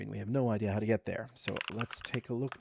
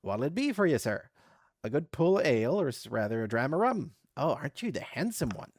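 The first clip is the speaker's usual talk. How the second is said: loud, higher flirtatious barmaid voice; flirty barmaid; 4 semitones higher, 5 dB louder than usual. Acted. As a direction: tease the customer like a barmaid. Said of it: "She tosses her head and replies".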